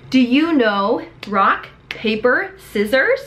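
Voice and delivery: slow, clear question with rising tune; slow teacher voice. Shown as a demonstration. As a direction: ask it slowly, like to a child.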